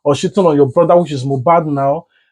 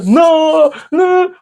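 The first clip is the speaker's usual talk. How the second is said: mock crying; 11 semitones higher than usual. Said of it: "I'm crying".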